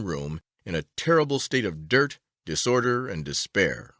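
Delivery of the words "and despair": usual speaking voice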